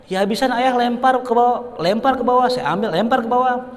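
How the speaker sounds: high, loud grievance; complaining son. Acted. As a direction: list the wrongs with rising annoyance.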